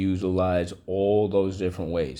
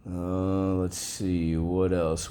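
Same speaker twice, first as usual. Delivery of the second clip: ordinary talk, nothing marked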